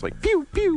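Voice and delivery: high zappy pew pew laser noises; laser sounds. Pretend. Podcast guest mocking sound effects with laser zaps. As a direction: spit out toy laser blasts. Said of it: "needs more sound effects, like lasers".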